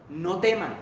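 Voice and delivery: normal talking voice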